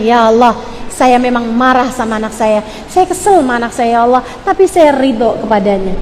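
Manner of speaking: low, subdued confession to God; model prayer. Shown as a demonstration. Mother confessing anger to God, low and accepting. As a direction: say it low and restrained, as a plea. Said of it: "kalau di saat marah kamu bilang".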